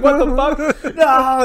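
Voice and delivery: high, loud comic cry; imitated movie scream; mock horrified shout. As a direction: cry out in hammed-up disbelief, half laughing. Acts out falling character's cry.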